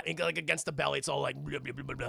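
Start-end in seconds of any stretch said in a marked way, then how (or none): 1.4-2.1 soft, low gurgling babble; low mock zombie gurgle. Zombie baby pressing against the belly, low gurgle.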